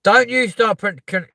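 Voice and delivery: high, loud protest breaking down into a long falling groan; mock outrage. Real, not acted. Scolding a viewer for correcting pronunciation, mock outrage. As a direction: burst out high, then groan as the words fail.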